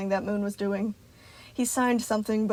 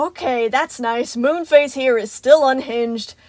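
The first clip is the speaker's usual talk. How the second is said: sarcastic; 4 semitones higher, 9 dB louder than usual. A narrator's reading. Roxy mocks Moon.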